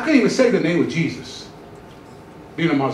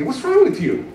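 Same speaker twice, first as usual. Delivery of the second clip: higher, pressing question from an unseen presence; a mysterious presence asking; 7 semitones higher than usual. Retold or imitated. Unseen presence in the car asks the driver, lightly pressing. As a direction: let the inner voice probe him a little higher. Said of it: "like, asking me".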